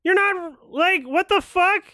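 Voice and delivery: loud, high disbelieving yell; exasperated shout. Real, not acted. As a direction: yell it out, fed up with the character. Yelling at a game character.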